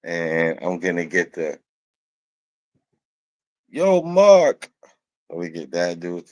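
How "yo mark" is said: high, loud imitated holler; catchphrase call-out. Teller quoting a comedy skit's catchphrase, loud mock holler. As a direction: call out like the character, pitched way up.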